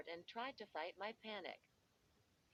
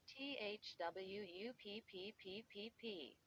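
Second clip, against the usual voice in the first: airy pop sound imitated; popping noise. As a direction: mimic a can popping open.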